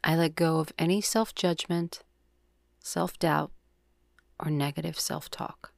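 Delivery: calm, measured yoga nidra guide; soothing meditation voice, words evenly spaced; guided meditation. Acted. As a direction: lead the breath with long, unhurried pauses.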